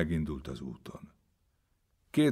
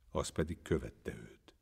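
ordinary delivery, nothing marked

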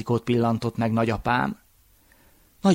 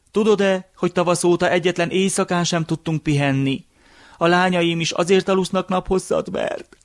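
lightly voiced old man, pitched higher; higher complaint that breaks off unfinished; tired old man; 6 semitones higher than usual. A narrator's reading. Old man explaining his sleeping daughters, mildly weary higher voice. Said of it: "s a fáradtságtól az öregember is eldőlt".

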